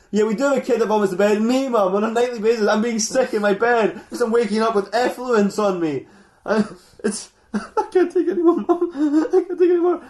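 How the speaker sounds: loud, high rant climbing into a laughing wail; mock hysterical outburst. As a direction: build from protest to hammed-up despair. [name]'s outburst at his mum.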